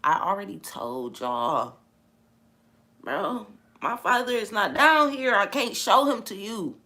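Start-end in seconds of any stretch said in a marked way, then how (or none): 0.0-1.8 slow, soft, steady; calm while beaten. Retold or imitated. Jesus being beaten, answering slowly and calmly. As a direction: draw it out, completely unbothered. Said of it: "this is how he was while he's getting beat".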